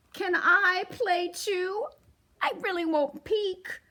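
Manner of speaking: bunny begs the mice to let him play; lightly voiced bunny. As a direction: ask sweetly like a little bunny.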